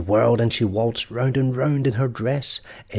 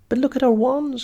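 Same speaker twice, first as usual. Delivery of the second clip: higher, dismayed cry; despairing cry; 11 semitones higher, 3 dB louder than usual. A narrator's reading. The twins see their wands broken, despairing cry. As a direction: wail over the broken wands. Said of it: "cried the twins in despair".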